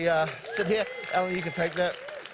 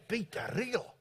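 put-on softer, questioning South African accent; 3 semitones higher, 7 dB quieter than usual. Colleague reacting to a familiar name, South African accent. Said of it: "doing my South African accent".

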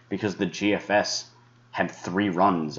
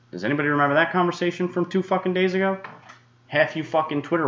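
sarcastic; 11 semitones higher, faster than usual. Real, not acted. Forecaster sarcastically taunts critics on Twitter. Sarcastic rant, high and fast.

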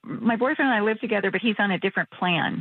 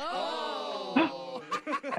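shocked gasp; 6 semitones higher, 11 dB quieter than usual. Real, not acted. Customer shocked by the bots' insult.

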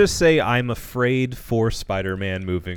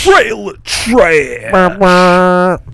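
slow, loud segment announcement; announcer voice; 8 semitones higher, 12 dB louder, much slower than usual. Acted. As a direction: boom out the segment title.

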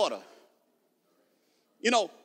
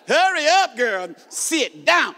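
playful raised order; mock-bossy call; mock command; 4 semitones higher, 5 dB louder, much slower than usual. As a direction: order them about in jest, punchily.

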